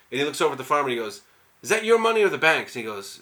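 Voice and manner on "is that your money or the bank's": higher and louder, demanding; robber's question. Retold or imitated. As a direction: grill the farmer, voice raised.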